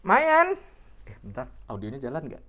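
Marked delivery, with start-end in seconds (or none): 0.0-0.5 excited. Real, not acted. Playful exclaim about the glasses.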